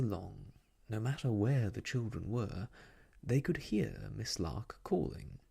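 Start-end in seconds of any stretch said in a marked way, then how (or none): none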